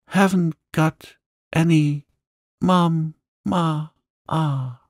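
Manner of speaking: put-on high, drawn-out little-girl wail; howling child. A narrator's reading. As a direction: howl it high, syllable by syllable. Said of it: "Lottie howled tempestuously".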